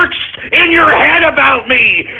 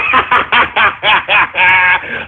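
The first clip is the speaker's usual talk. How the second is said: long deep villain cackle; evil cackle; 7 semitones lower than usual. Acted. Streamer mocking trolls with an evil cackle. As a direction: cackle like a mad overlord.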